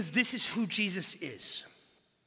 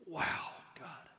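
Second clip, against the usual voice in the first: a whispered wow, struck by God; awed whisper; 4 semitones lower, 9 dB quieter than usual. Real, not acted. Preacher to congregation, marvelling at God: awed whisper. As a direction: breathe it out in a hushed whisper. Awed aside about God's love.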